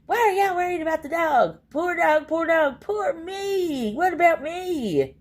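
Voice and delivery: high, whining mock of Tammy; imitating a whiny streamer who waves off questions about her dog; mock whiny self-pity. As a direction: whine it like a self-pitying beggar. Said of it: "she'll go".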